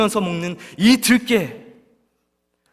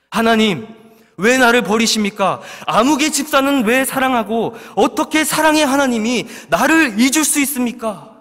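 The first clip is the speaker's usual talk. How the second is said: loud, high pleading complaint to God; resentful cry; 6 semitones higher, 4 dB louder than usual. Acted. Believer crying out to God in resentment, loud and high. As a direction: cry out in grievance, voice raised high. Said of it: "원망하며 부르짖습니다".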